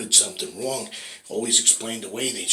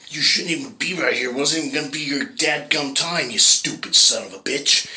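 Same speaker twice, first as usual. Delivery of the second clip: louder, raised, berating; furious God; 6 semitones higher, 7 dB louder than usual. Acted. God berating the man at heaven's gate, louder and furious. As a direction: chew out a fool.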